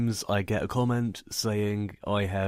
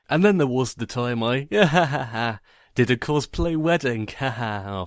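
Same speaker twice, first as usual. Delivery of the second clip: forced laughing; 3 semitones higher, 5 dB louder than usual. Retold or imitated. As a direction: fake cheerful laughter, higher and louder. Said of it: "I was also asked to laugh".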